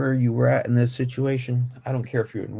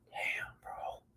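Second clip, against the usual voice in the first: hushed, breathy reaction; whispered damn; 16 dB quieter than usual. Real, not acted. Commentator reacting to the story, hushed whisper. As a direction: breathe it out low.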